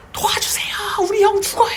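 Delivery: very high, panicked wail; imitated child screaming. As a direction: play a terrified child crying out for help. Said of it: "그러면서 막 소리를 질렀는데".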